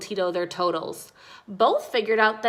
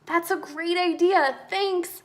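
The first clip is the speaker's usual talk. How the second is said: put-on much higher, grateful child voice; light up at the good idea; high-pitched happy reply; delighted thanks; 8 semitones higher than usual. A narrator's reading. Tomas delighted, thanks Mia.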